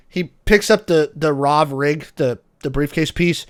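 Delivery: ordinary talk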